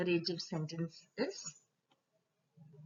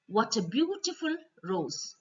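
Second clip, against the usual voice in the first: high, delighted gush over a flower; admiring exclamation; 8 semitones higher than usual. Acted. Admiring a rose, delighted. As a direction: marvel at the rose.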